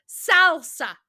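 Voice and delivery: emphatic repeat. Acted. Customer repeats sauce order to server, exasperated. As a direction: punch it out for someone who missed it.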